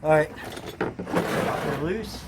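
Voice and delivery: slow strained words under the car; lying under a car. Real, not acted. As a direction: push out the words mid-effort. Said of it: "I know I'm under the car now".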